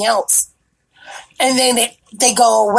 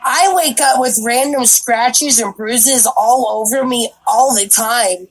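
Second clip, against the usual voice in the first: ordinary delivery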